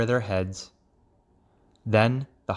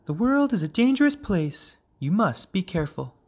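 high-pitched cautioning mother voice; mother frog warning; 8 semitones higher than usual. A narrator's reading. Mother to her young leaving the pond, high warning.

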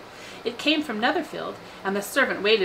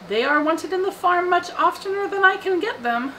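high-pitched character voice for the father; wry father; 11 semitones higher than usual. A narrator's reading. Father answering his wife about the farm horses, wry. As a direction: answer the wife in a sustained, much higher pitch.

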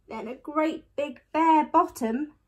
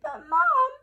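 put-on high, louder child's gasp; gasped protest; 13 semitones higher, 5 dB louder than usual. A narrator's reading. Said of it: "gasped Eddie".

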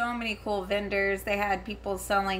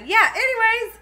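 giggly anyways; 12 semitones higher, 10 dB louder than usual. Real, not acted. Still laughing after the slip.

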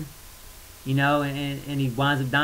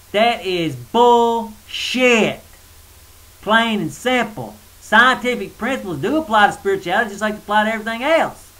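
irritated; 4 semitones higher, 5 dB louder than usual. Real, not acted. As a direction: hammer it home, fed up. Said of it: "I just get a little irritated".

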